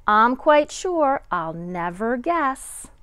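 lively picture book read-aloud with a sing-song lilt; reading to children. A narrator's reading. Kindergarten teacher reading a picture book to her class, lively. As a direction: read it like story time for kindergarteners.

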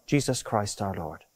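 ordinary delivery

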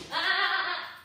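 a short loud "Ah!" to stop the dogs; sharp correcting shout. Real, not acted. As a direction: bark out a quick "Ah!" so they back off. Correcting dogs rushing food.

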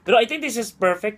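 eating crunchy snack; talking while chewing. Real, not acted. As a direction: mouth partly full. Seen 0: Man holds snack tub, lips pressed, jaw working mid-sentence.

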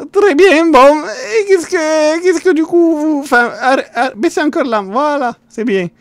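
high, loud squeaky voice breaking into laughter; high character voice, laughing. Acted. Streamer in character cracking up, high and loud. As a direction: push the silly voice high and loud.

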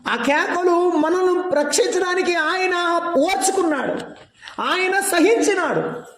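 high, slow preached cry; preaching. Acted. Preacher to the church, high and slow. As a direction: draw out the suffering.

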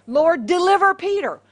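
loud, high acted plea; fervent prayer. Christians pray for Peter's deliverance, loudly fervent. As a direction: cry out to God for rescue.

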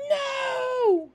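high, loud desperate cry; scream. A narrator's reading. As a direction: scream to stop the knife. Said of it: "screamed Nick".

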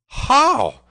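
very high, loud exclamation; astonished cry. A narrator's reading. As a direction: cry out in amazement. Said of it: "cried the tanner".

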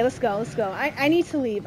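usual speaking voice

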